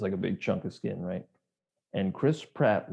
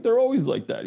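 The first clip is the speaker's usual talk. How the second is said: much higher mock teen voice; dismissive teen; 13 semitones higher, 5 dB louder than usual. Older brother dismissing his sibling, mock teen. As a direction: brush it off like a bored kid. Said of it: "he's like".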